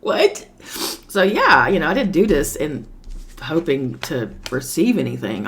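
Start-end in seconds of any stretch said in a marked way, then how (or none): 0.0-0.5 sudden high squeak of disbelief; a startled, much higher "What?"; shocked what. Retold or imitated. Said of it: "And I was like".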